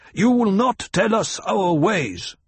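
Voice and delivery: angry snapped retort. A narrator's reading. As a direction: snap it back at her. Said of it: "Jameis snapped".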